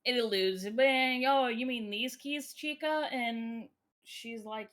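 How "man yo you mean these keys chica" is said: taunting turtle. Retold or imitated. Voices the turtle taunting with keys.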